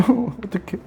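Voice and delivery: soft, high laughing aside; flustered laugh. Real, not acted. Teacher realizing they must hurry, flustered laughing. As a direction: laugh quietly through the words. Flustered at running behind schedule.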